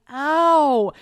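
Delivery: loud, high echoed ow; long, hammed-up copycat cry; mimicked pained noise. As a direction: parrot a yelp of pain. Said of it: "She's, like, mimicking my noises".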